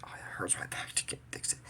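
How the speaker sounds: whispered whine about a sore back; whispered complaint. Retold or imitated. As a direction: hush it, a mock brother's grumble. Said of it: "All he did was like".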